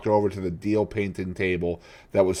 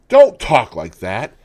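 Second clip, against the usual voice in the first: mildly voiced pleading; 8 semitones higher, 7 dB louder than usual. Painter pleading.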